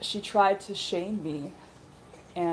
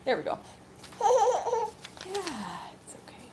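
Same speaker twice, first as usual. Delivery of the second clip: gently calm the little one; 13 semitones higher than usual. Real, not acted. Comforting hurt baby.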